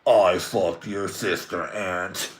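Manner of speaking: slow, heavy villain confession; Darth Vader parody. Acted. Vader confesses to Luke, slow parody villain voice. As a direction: draw it out as a mock dark lord. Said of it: "it's just like Luke Skywalker finding out".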